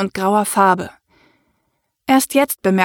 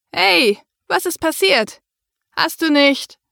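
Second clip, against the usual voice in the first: put-on dazed teen; 7 semitones higher than usual. A narrator's reading. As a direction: wake up groggy and bewildered. (benommener Teenager)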